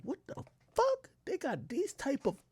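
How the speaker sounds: very high, soft disbelief; stunned squeak. Retold or imitated. Sees flamingos from the car, high stunned squeak. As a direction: squeak in shock.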